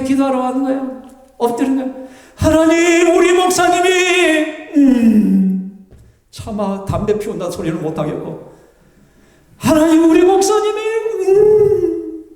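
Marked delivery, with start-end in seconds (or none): 2.3-10.8 mock crying prayer. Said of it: "막 우는 거예요". 11.2-12.2 mock sob. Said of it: "막 우는 거예요".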